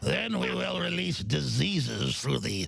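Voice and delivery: brief the war plan like a cold British villain; authoritarian British accent. Pretend. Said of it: "Very authoritarian British accent".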